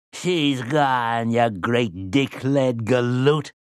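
put-on taunting like an old witch. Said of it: "cried Rhea of the Coos".

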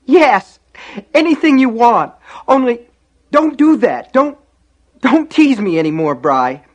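put-on high, loud child in hysterical panic; wailing little brother begging; frantic pleading. A narrator's reading. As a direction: bargain desperately, at the top of your voice. Terrified boy bargains for brother's life.

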